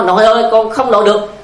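mock cry of a scared little girl; a hint of a frightened child's shout. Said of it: "nó la lên".

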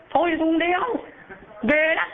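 high, sharp wife's refusal; first wife refusing. Acted. First wife refusing her dying husband, high and firm. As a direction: turn him down in a high voice.

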